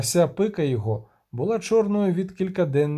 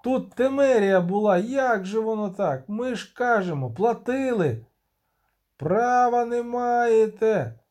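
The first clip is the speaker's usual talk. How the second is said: settler protesting; 7 semitones higher than usual. Acted. Поселенець протестує.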